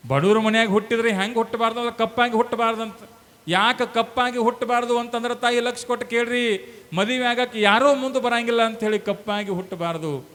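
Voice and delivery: high, loud exhortation; full-force preaching. Real, not acted. Preacher tells mothers why poor daughters suffer, in full-force preaching.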